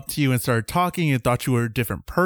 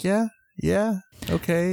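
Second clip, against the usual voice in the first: higher-pitched, unsure agreement; imitating own awkward compliance; awkwardly going along; 5 semitones higher, slower than usual. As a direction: agree meekly to avoid correcting him. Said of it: "sociability to just correct him".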